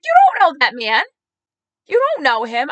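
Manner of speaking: loud, high retold argument; acted-out incredulous telling-off; exasperated scolding. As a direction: argue back at mom in disbelief. Acted quarrel with mother.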